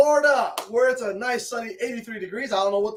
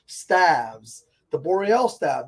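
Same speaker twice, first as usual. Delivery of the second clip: ordinary talk, nothing marked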